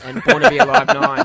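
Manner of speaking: loud, high triumphant finish. Real, not acted. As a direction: crow the last one. Triumphant end of score count.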